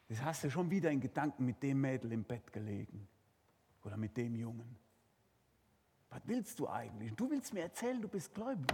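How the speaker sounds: soft, hushed accusing voice; enemy whispering. Acted. Enemy of God whispers to the believer, hushed and taunting. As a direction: murmur the accusations low and close. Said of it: "und flüstert".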